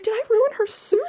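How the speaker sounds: high-pitched mock worry; retold guilty worry. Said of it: "I felt really bad".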